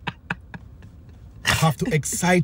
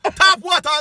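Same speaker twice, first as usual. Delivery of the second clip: scoffing shout; 10 semitones higher than usual. Retold or imitated. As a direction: bark out the cheap option. Scoffing at friends' drinks.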